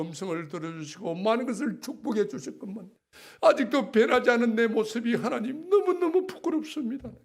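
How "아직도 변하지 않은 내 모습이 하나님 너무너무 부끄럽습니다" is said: impassioned preaching. Real, not acted. Preaching at full force.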